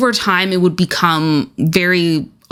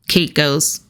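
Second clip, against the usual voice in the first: normal talking voice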